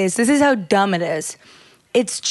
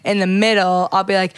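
usual speaking voice

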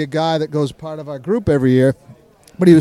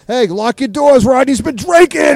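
mock yell, higher and drawn out; imitated yelling; 7 semitones higher than usual. Partygoers yelling a warning about Rodney, imitated yell. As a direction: call a mock warning across a bar. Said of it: "people were yelling shit like".

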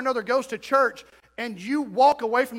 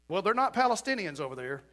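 usual speaking voice

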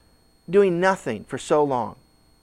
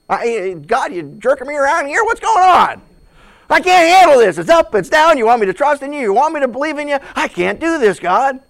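frustrated outburst; 7 semitones higher, 8 dB louder than usual. Retold or imitated. Believer venting at God.

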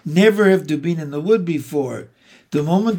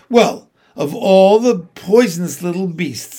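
louder, scornful rebuke; indignant; 3 semitones higher, 5 dB louder than usual. A narrator's reading. Peter rebukes Edmund for telling lies, indignant. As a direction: let the outrage land on the insult.

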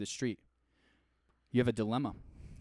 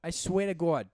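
higher, louder Boston oath; Boston accent; 5 semitones higher, 5 dB louder than usual. Acted. Exasperated over a missing hash brown, Boston accent. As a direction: drop the r like a Bostonian. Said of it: "that's so Boston".

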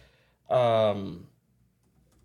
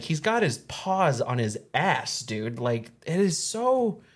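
ordinary talk

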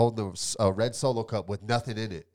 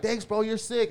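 impressed; 9 semitones higher than usual. Retold or imitated. Quoting Swayze's thanks.

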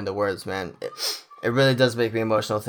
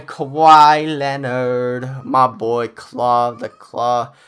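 loud, stretched-out announcer call of the name; arena announcer intro; 6 dB louder, much slower than usual. Acted. Fan introducing his star player to listeners, arena-announcer style. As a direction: drag the name out like a hype man at the arena.

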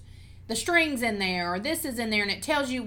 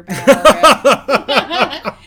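laughing loudly through the words; words bursting with laughter; 3 semitones higher, 18 dB louder, much slower than usual. Real, not acted. Laughing while telling about elf.

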